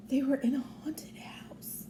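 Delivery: hushed, breathy storyteller whisper; spooky whisper. A narrator's reading. Narrator telling children the scary part, whispering. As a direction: drop to a whisper for suspense.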